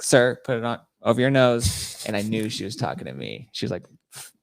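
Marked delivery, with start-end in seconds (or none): 0.0-2.0 TSA lady. Retold or imitated. Acting TSA lady's first order.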